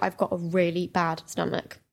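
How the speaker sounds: low, soft, slow poorly excuse; feigned illness. Pretend. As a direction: plead a bad stomach, mock-feeble.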